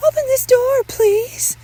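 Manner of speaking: very high, loud plea; urgent motherly demand. Acted. Mother asks son to open door, high loud demand.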